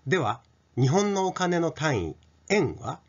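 normal talking voice

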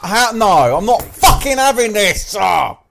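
real gamer outburst; outraged yell. Real, not acted. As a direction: explode in furious protest. Outraged at dying in game.

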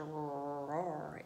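low, rolling roar on the word; drawn-out roar. A narrator's reading. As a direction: stretch the word into a slow roar. Narrator demonstrates the story's last word.

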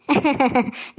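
brief chuckle before answering; short laugh. Acted. Son to mother, asked about the girl, short laugh. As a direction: let out a short, light laugh.

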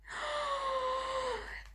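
long, high gasp; stunned gasp. Real, not acted. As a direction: draw in a long shocked breath. Recognises another big gift.

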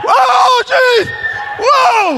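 very high, loud frightened whoa; startled yell. Acted. Speaker startled by figure in staged scare, shrieked alarm. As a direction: cry out as if jumped by something.

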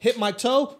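ordinary talk

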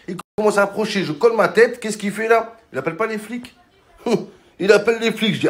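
usual speaking voice

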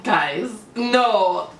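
normal talking voice

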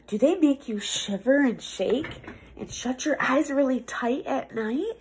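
ordinary delivery

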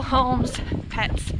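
ordinary talk

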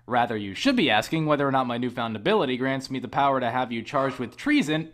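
ordinary delivery

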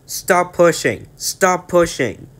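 high, loud, slow pleading shout; desperate shout. Acted. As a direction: beg the trucks to stop. Thomas pushed too fast downhill.

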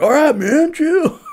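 high, loud imitation of a spooked golfer; mock chill plea. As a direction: squeak it like a rattled golfer. Other golfers told to calm.

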